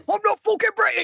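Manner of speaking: high, hammed-up British accent; exaggerated British indignation; mock British protest. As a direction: protest your innocence in broad British. Echoes the joke denial in accent.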